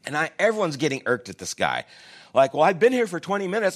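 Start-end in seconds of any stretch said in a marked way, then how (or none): 2.5-3.8 higher, fast huffy grumble; mock the annoyed customer's impatience; impatient complaint.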